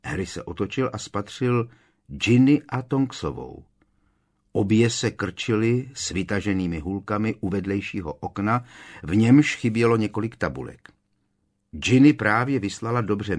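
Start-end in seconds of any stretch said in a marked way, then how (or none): none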